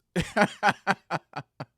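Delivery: long, loud, high laughing fit; real laugh. Real, not acted. Amused by the break your face threat, loud laughing. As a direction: crack up after the punchline.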